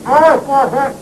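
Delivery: muffled mumble; mouth full. Acted. Asked for the key, he gives a mouth-full mumble. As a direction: answer wordlessly through a stuffed mouth. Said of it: "Don't talk with your mouth full".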